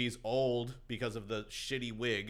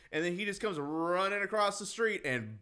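ordinary talk

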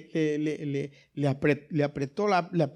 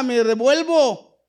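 much higher and louder, slow and firm; loud emphatic; 6 semitones higher, 11 dB louder, much slower than usual. Real, not acted. Preacher shows how he would turn back, loud and firm. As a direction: shout the decision, no questions. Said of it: "Yo hubiera corrido".

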